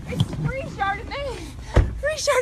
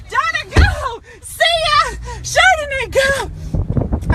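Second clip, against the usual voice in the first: loud cheer; 3 semitones higher, 5 dB louder, slower than usual. Acted. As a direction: shout it out to Donna. Cheering off at friend.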